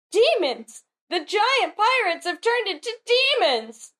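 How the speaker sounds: high-pitched acted alarm; mock frightened kids. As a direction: cry out like children scared of demons. Voicing frightened kids in manga.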